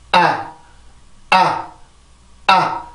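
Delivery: 아 with a brief glottal catch; 아 squeezed at the vocal cords for stress; 아 stressed by tensing the throat; stressed a vowel. Shown as a demonstration. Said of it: "이렇게 변형을 시켜주면".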